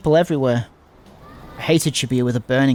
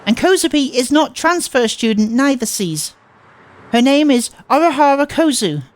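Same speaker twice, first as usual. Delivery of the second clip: put-on cutesy girl voice; 9 semitones higher than usual. Transfer student soothing Nishizuo-kun, high cutesy baby talk.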